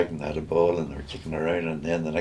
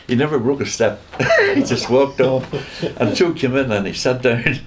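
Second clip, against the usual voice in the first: laughing; 5 semitones higher, 4 dB louder than usual. Real, not acted. Laughing while telling priest story.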